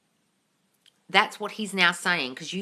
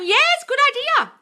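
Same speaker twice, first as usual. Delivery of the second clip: high, slow gushing yes; gushing approval; 7 dB louder than usual. Real, not acted. Host approving a viewer's idea, high and gushing. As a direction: draw out the yes with delight.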